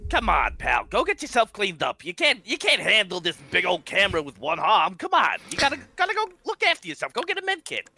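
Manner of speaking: a gruff buddy shooing him off; high, fast matey urging; Schmitty's voice. Acted.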